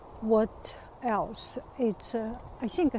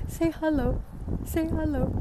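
higher, sing-song coaxing; coaxing pet voice; 6 semitones higher than usual. Real, not acted. To the pet, urging it to greet the camera, sing-song coaxing. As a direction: coax the little one to say hello.

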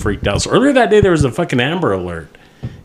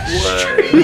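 ordinary talk

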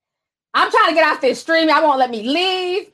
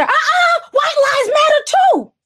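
mock heckler; 12 semitones higher than usual. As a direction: ham up the contrarian shouting back. Mocking a whataboutism reply.

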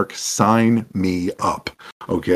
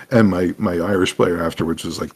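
ordinary talk, nothing marked

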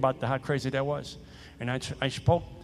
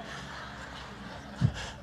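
breathy laugh; 9 dB quieter than usual. Real, not acted. Laughing at own story.

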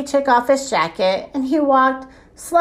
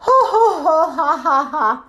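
acted ho-ho-ha-ha belly laugh, pitched up; hearty walrus laugh; 6 semitones higher, faster than usual. A narrator's reading. Walrus king amused by the bus boy, hearty laughing. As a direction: laugh it out in bouncing bursts. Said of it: "Then he laughed some more".

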